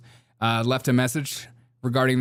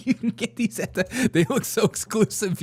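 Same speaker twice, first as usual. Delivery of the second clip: higher, laughing through the words; laughing mock voice; 9 semitones higher than usual. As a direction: giggle out a snooty mock. Laughing mock of search results.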